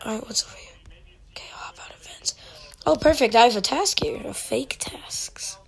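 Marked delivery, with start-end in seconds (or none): 0.4-2.3 whispering. Real, not acted. Sneaking in game. 4.2-5.6 whispering. Real, not acted. Aside as imposter.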